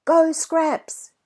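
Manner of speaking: loud, high cheering yell. A narrator's reading. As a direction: cheer the dog on at the top of your voice. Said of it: "yells Jack".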